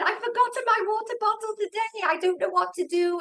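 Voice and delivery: put-on high, quick, flustered child voice; flustered little boy. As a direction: play the worried kindergartner, fast and high-pitched. Kindergarten boy voiced in story.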